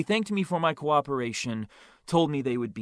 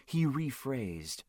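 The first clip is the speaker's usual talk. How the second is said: usual speaking voice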